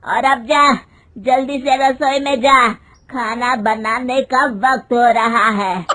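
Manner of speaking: loud, stern command to go; angry order. Acted. Mother-in-law ordering daughter-in-law to the kitchen, loud stern. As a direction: send her off to the kitchen sharply.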